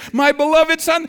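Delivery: high, loud delighted exclaim; proud father. Retold or imitated. Father bursting with pride over his newborn, high and loud. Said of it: "Isn't that what the father says?".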